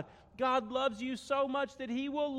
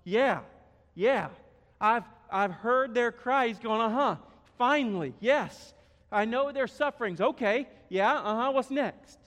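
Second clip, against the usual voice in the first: louder, keen yeah-yeah agreement; eager Moses; 3 semitones higher, 8 dB louder than usual. Acted. Moses hurrying God along, loud and eager. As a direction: nod along, impatient for the next part. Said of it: "And, and Moses is going".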